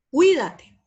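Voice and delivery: CUÍDATE with "cui" as one loud, high burst; one-syllable diphthong. Shown as a demonstration. Teacher showing a diphthong to students, "cui" in one syllable. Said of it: "va en una sola sílaba".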